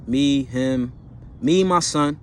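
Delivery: normal talking voice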